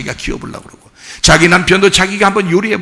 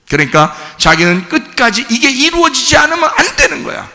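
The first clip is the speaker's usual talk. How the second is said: ordinary talk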